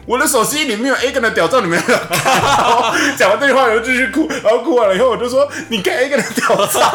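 high, loud laughing retelling; can't stop laughing while telling it; cracking up mid-story. Real, not acted. Laughing through embarrassing drunk story.

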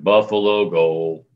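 low, slow, deadpan goal call; downplayed announcement. Shown as a demonstration. Announcer calls the visiting team's goal, downplayed. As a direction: announce it with no excitement, dropped low. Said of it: "style is such that you downplay".

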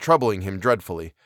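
plain reading voice

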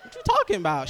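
high, loud, laughing confusion; laughing disbelief. Retold or imitated. Answers mom, laughing confused voice. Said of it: "I'm like".

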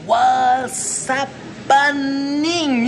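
Chicano greeting. Shown as a demonstration. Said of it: "watch this here".

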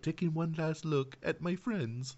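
slow, soft, faraway robot farewell; lightly voiced sad little droid; wistful droid farewell. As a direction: play a droid saying goodbye. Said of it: "he's all like".

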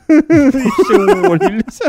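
high, loud laughter through words; laughing. Real, not acted. Laughing as he tells friend the news. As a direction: burst out laughing.

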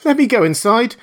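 high, loud, tearful begging; frantic tearful plea; weeping, frightened plea. Acted. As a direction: beg through panicked tears. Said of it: "staggering and weeping".

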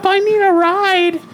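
high, slow fake girl voice; high sweet girl on the phone; girly voice. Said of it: "You should call him".

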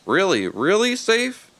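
high, slow nervous critter; timid pleading hedgehog. A narrator's reading. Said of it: "added a nervous hedgehog".

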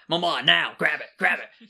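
loud eager demand; urgent, bossy cat pleading; excited cat voice. Pretend.

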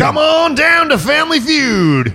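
high, drawn-out game show host call; hammed-up game show announcer; Richard Dawson. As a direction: belt out a slow, high show invitation. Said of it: "doing a Richard Dawson thing".

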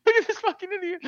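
very high, squeezed out through laughter; mock someone while cracking up; helplessly amused, high-pitched retelling; tearful laughter. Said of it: "Tears almost laughing at how angry he is".

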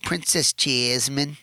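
drawn-out "Jasmine" in a thick put-on accent; daughter's accent. Dad quoting his daughter's favorite princess, slow accented imitation. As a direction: imitate a little girl's strong accent, slowly. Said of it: "Where did you get this accent from?".

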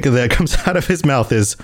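normal talking voice

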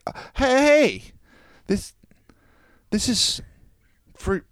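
high, loud startled greeting, then halting; guilty, caught out. Retold or imitated. Tenant caught outside by the owners, acted guilty fluster. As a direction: act out being caught, fumbling through the handover. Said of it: "I just looked so guilty".

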